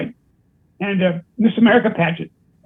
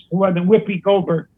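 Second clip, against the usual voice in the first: ordinary talk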